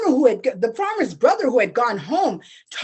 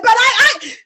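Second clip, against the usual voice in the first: flustered stammer; 10 semitones higher, 8 dB louder than usual. Acted. Brother stammering caught.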